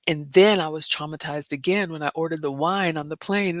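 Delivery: usual speaking voice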